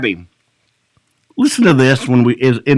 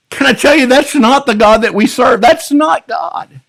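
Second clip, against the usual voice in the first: ordinary talk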